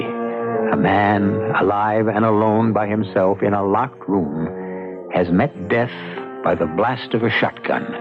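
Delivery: ordinary talk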